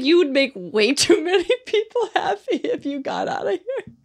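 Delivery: high, laughing mock-cruel quote; ex-husband's deadpan joke, retold laughing. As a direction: tease her while cracking up. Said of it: "He's like".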